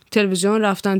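usual speaking voice

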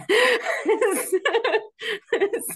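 real laugh, high and loud; high burst of laughter. Real, not acted. Guest laughing at her old habit, high laughter.

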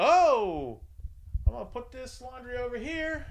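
high, awkward mom voice, loud on the oh; startled mom. Retold or imitated. Mom walks in on the couple.

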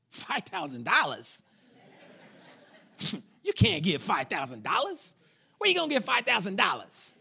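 scoffing voice of doubt, put on; mocking second voice. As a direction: scoff at the amount in a put-on, laughing voice. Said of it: "You hear a second voice".

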